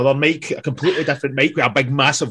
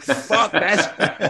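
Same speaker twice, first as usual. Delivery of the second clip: high, laughing retold panic; amused mock alarm; laughing fuck this; 8 semitones higher than usual. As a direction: laugh it out like you're fleeing.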